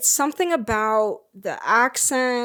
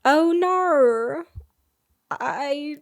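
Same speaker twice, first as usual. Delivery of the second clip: higher-pitched, hammed-up Aussie accent; bad Australian accent; 5 semitones higher than usual. Shown as a demonstration. Showing co-host a best attempt, bad Australian accent. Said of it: "It's really bad".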